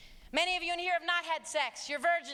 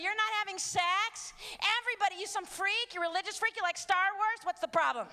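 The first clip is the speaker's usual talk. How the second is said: mocking classmates; 6 semitones higher than usual. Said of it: "Kids at school are gonna go".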